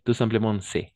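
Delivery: c'est said as "sé", no S sounded; teacher models c'est with a silent S; c'est without the S. Shown as a demonstration. Teacher modelling c'est for the class, no S sounded. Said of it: "on va prononcer c'est. Pas avec le S".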